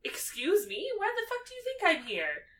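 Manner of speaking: sassy Hermione. Retold or imitated. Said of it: "she's like".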